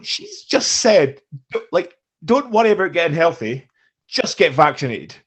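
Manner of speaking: loud, incredulous mock quote; indignant retelling. Mocking a spokeswoman's health advice, indignant.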